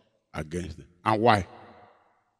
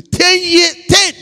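loud, high shout; 14 semitones higher, 10 dB louder, much slower than usual. Real, not acted. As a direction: shout out the years. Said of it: "shouted emphasis".

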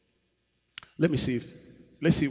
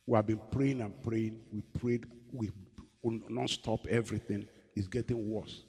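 troubled wife; 5 dB quieter than usual. Retold or imitated. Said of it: "He said".

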